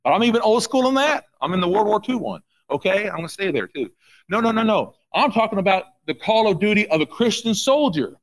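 usual speaking voice

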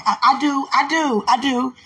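high, eager mock vows; excited i do. Mock wedding vows to Dwight.

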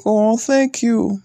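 high, slow, breathy-sweet thank you; mock girl voice. Mimicking the crying girl thanking him, in a high, slow mock voice. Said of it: "He's like".

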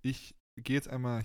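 ordinary talk, nothing marked

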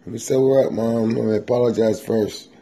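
pleading, ducking the blessing. Retold or imitated. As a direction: beg mom to wait while you sober up.

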